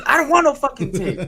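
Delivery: high, loud refusal; mock irate customer. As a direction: squawk it back at the salesman. Imitated customer angrily refusing.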